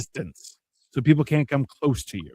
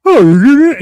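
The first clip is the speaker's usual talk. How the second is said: mock ad actor; 10 semitones higher, 12 dB louder than usual. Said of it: "he's like".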